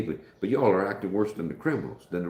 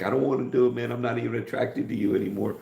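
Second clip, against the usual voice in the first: raised, pleading mock complaint; reluctant protest; 6 semitones higher than usual. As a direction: act out an unwilling husband pushing back. Acting reluctant husband's protest.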